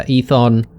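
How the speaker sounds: Aethon said as "EE-thon"; pronunciation variant. Shown as a demonstration. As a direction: start Aethon with a long "ee", then "thon". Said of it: "not sure how to pronounce that".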